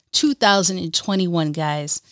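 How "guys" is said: normal talking voice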